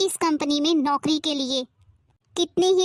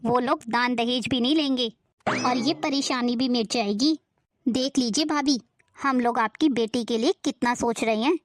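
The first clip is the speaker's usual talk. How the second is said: lower, harsh nagging aunt voice; a suspicious, scolding aunt pitched low; 4 semitones lower than usual. Acted. Aunt scolding Neha and her mother over the letter, in a lower nagging voice.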